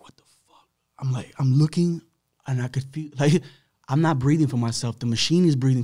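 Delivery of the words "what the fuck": breathless whispered disbelief; whispered shock. Retold or imitated. Waking on the ventilator, whispered shock.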